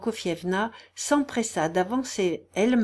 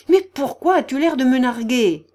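loud, raised angry challenge; furious vociferation; 6 semitones higher, 9 dB louder than usual. A narrator's reading. The general's wife challenging the smirking invalid, furious. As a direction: lash out at the mocker. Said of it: "vociféra-t-elle furieuse".

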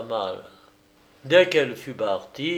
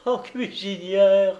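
smiling through tears; 8 semitones higher, 4 dB louder, slower than usual. Acted. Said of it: "qui souriait à travers ses bonnes larmes".

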